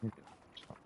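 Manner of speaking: soft breathy whisper, no voice; whispered reassurance. Pretend. Gamer whispering reassurance to himself. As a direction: whisper it to yourself.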